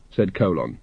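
ordinary delivery

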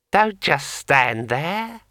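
high, slow, eerie ringing voice; like a finger circling a wine glass rim; talking sword. A narrator's reading. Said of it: "in a voice like the sound of a finger dragged around the rim".

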